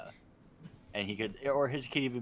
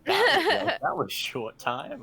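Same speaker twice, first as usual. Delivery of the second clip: mock misheard catchphrase; 9 dB louder, slower than usual. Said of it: "or whatever he said".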